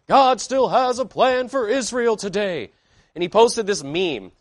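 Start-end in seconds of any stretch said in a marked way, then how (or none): 0.1-2.7 higher-pitched, drawn-out mock pastor slogan; mimicking pastors. Preacher parroting what every pastor says, mimicking pastors. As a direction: parrot a pastor's stock line, slowly and high. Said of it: "haven't we heard every pastor in America say that".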